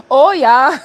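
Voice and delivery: playful mock German accent; drawn-out "Oh, yeah" with a German accent; best German accent. Streamer savoring the satisfying job, in a mock German accent. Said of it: "In my best German accent".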